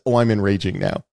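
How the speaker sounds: normal talking voice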